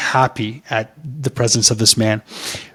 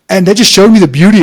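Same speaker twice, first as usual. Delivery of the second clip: excited; 7 semitones higher, 9 dB louder than usual. Real, not acted. Moved, enthusiastic praise.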